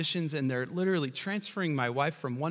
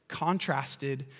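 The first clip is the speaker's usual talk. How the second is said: ordinary talk, nothing marked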